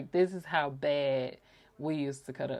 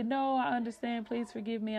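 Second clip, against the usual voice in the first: higher, pleading quote of her sister; sister's voice; 8 semitones higher than usual. Retold or imitated. Sister begging her forgiveness, in a higher pleading voice. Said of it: "And she would say".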